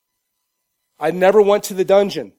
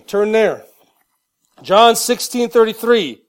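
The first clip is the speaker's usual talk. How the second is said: normal talking voice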